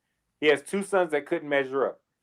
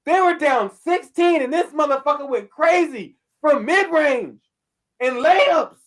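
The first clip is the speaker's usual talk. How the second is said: hyped rant; 7 semitones higher, 3 dB louder than usual. Real, not acted. Hyped rant about Butler's game.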